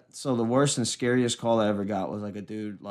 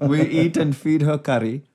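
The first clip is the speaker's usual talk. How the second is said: louder mock Indian accent; hammed-up comic East Indian accent; 3 semitones higher, 5 dB louder than usual. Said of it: "a East Indian accent".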